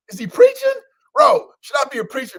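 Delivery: loud, high amused exclaim; high excited yelp. Real, not acted. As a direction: shout it in playful disbelief. Mock-surprised at himself, yelling.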